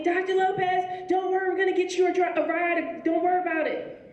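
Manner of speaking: eager security guard. Retold or imitated. Security officer reassures her.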